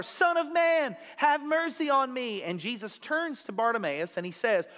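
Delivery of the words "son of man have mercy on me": higher, louder desperate plea; crying out louder. Retold or imitated. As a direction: shout it over the crowd. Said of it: "so he cries out louder".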